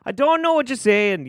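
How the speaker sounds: high, loud Yooper put-on; slipping Yooper accent. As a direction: protest in a wobbly Yooper accent. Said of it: "I'm, I'm slipping".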